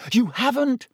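restrained high-pitched yell. A narrator's reading. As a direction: protest in a high, half-yelled voice. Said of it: "Harry yelled".

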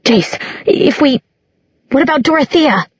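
high, shrill panicked question. A narrator's reading. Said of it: "Clary's voice came shrilly out of her mouth".

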